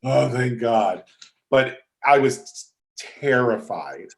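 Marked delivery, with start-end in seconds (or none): 0.0-1.0 slow sigh of relief; acted relief. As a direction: let the relief out slowly. Acted relief after approval.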